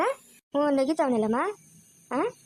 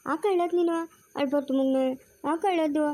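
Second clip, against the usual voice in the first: ordinary delivery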